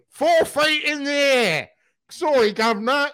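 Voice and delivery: high, slow, plummy English impression of Troy; mock English accent. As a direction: ham up a posh English lilt. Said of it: "Troy went so English in that scene".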